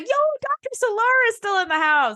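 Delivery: high, excited fan shout-out; hyped fan cheer. Retold or imitated. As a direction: cheer like a fan spotting a favourite. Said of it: "And I was like".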